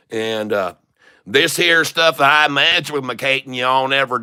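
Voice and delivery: lightly put-on Southern hillbilly accent; country western drawl. Streamer hamming it up for chat in a light country accent. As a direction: play a folksy Southern stock guru, a mild twang throughout. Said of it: "country western talking long enough".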